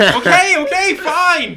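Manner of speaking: high, loud giggly give-in; caving in mid-laugh, high and loud. Retold or imitated. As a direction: laugh through the grudging okay. Giving in while laughing at memory.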